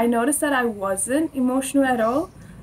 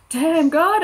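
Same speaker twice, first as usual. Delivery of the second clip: higher, louder mock outburst to God; retold amazement; 6 semitones higher, 5 dB louder than usual. Young believer telling friends how they talk to God, mock amazement. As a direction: exclaim it upward, lightly acted.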